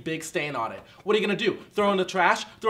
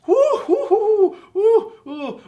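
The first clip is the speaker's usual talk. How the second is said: whooping; 13 semitones higher, 8 dB louder, much slower than usual. Acted. Mock infomercial host losing it.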